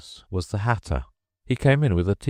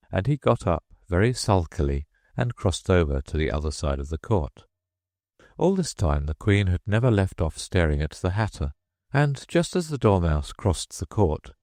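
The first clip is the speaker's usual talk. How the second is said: ordinary delivery, nothing marked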